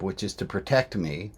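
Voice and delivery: ordinary talk, nothing marked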